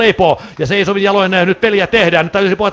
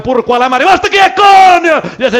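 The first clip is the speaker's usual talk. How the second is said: louder, higher burst with a laugh; excited shout; 9 semitones higher, 5 dB louder than usual. Real, not acted. Commentator calling a blocked slapshot, excited shout with a laugh.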